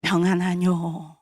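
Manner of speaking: low, slow, solemn voice of Jesus; Jesus speaking peace. Retold or imitated. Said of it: "예수님이 제일 먼저 하신 말씀".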